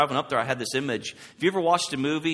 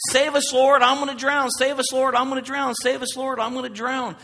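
high, loud pleading cry to be saved; panicked plea; 11 semitones higher, 5 dB louder than usual. Pretend. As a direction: beg like you're going under. Panicking disciples in the storm.

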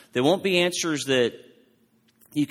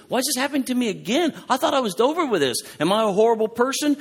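high, fast, pleading inner voice; anxious self-questioning; 11 semitones higher, 3 dB louder, faster than usual. Retold or imitated. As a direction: rush the worried questions upward. Anguished self-questioning quoted aloud.

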